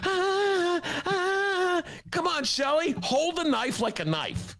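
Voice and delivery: high, slow squealing voice; host doing Shelley Duvall on request; Shelley Duvall impression. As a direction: play the frightened wife, high and drawn out. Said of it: "great impression of Shelley Duvall".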